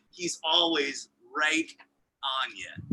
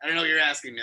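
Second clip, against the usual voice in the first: normal talking voice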